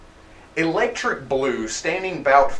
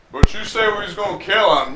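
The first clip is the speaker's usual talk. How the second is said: higher, quick dim-henchman protest; confused henchman; 5 semitones higher, faster than usual. Acted. Henchman querying his boss's plan, mildly confused. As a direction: question the boss, quick and higher.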